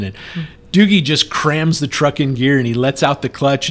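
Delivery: ordinary talk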